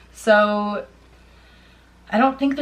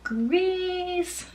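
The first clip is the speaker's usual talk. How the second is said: playful announcement; 10 semitones higher than usual. Real, not acted. Announcing the next favourite film.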